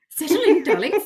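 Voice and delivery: high, loud play-acted fussing; mock storytime host. Host joining the reader's storytime joke, high mock-doting call.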